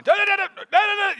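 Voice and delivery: high, loud mock yelling gibberish; mimicking locals who sound like they're yelling; imitating loud chatter. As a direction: fire off nonsense like a heated dinner talk. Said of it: "they look like they're yelling at each other".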